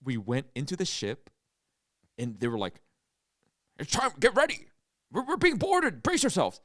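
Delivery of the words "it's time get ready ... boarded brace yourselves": high, loud urgent warning; hammed-up panicked officer on a ride; alarmed crew member. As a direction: shout orders as the ship is boarded. Acts ride crew warning of boarding.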